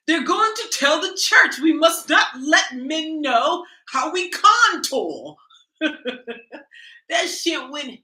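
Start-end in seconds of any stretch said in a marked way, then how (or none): 0.1-5.4 lightly mock British accent. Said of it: "a British accent".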